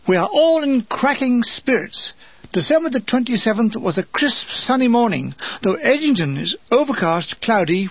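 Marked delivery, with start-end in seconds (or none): none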